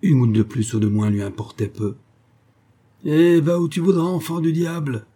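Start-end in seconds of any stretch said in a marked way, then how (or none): 3.0-5.0 loud, high outburst of anger; raised-voice, enraged dismissal; furious cry. A narrator's reading. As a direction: curse the servant out of the house. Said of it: "s'écria Jacques, furieux de se voir tenir tête".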